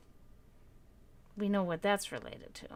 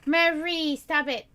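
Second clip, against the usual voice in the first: mild scold; 6 semitones higher, 5 dB louder, slower than usual. Real, not acted. Telling off the cat.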